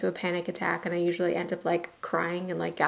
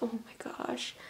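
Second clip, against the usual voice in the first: whispered sympathy; 3 semitones higher, 12 dB quieter than usual. Real, not acted. Sympathizing with overwhelmed employee.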